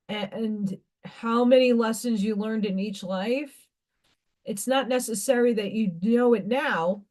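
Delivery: normal talking voice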